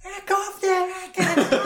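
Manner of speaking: very high squawking comeback; mock defensive screech. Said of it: "just go on the offensive".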